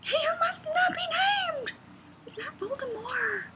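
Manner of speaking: very high squealed fake indignation; theatrical high-pitched horror; mock outrage. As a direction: protest in a hammed-up, squeaky falsetto. Acts out outrage at the mispronunciation.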